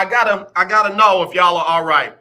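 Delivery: normal talking voice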